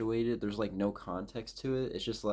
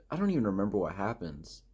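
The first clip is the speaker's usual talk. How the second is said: ordinary talk, nothing marked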